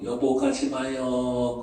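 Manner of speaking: slow, drawn-out plea of a wife; lightly acted clinging plea; imitated pleading. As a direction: beg your husband to stay, stretching the words. Retold wife begging husband to stay.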